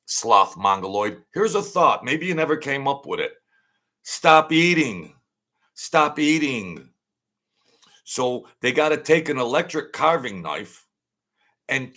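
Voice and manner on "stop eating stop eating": slow, loud, higher: stop eating; loud stern order. Real, not acted. Ranting at a man who can't stop eating, loud slow command. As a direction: bark it slowly like a fed-up scold.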